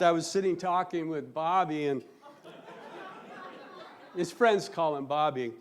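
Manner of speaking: ordinary delivery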